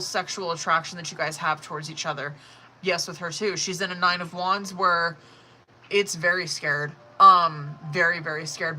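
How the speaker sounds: ordinary talk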